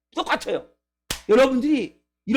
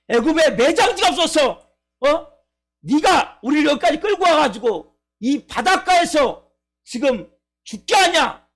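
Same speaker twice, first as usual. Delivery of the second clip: higher-pitched indignant crowd voice, punching the accusations; acted angry blaming; 3 semitones higher than usual. Israelites turning on Moses at the Red Sea, angrily resentful. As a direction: berate your leader in mock outrage, building to a high final insult. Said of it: "모세를 막 원망하기 시작하죠".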